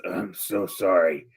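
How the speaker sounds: low, slow, soft apology; hushed apology. Acted. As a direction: apologize quietly on your way out. Tor apologizes while leaving villagers.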